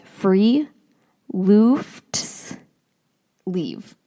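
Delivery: free lufts leave, each part separated with pauses; careful book pronunciation free lufts leave, slow and split; spelled-out pronunciation. Shown as a demonstration. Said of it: "the pronunciation is".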